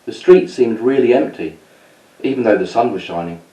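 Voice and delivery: put-on lively read-aloud storytelling for kids; story narration. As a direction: tell it like a bedtime story to children.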